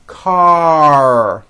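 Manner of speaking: long "Car", closed on a strong R; strong final R. Shown as a demonstration. Teacher shows closing the word, "Car" with long strong R. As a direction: stretch "Car" and pull back into R. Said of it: "you need to close the word".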